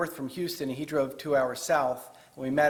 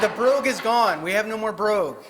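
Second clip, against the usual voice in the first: laughing through the words, louder and higher; real amused laugh while speaking; 8 semitones higher, 8 dB louder than usual. Real, not acted. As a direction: chuckle out the joke to the room. Joking with the priest about accents.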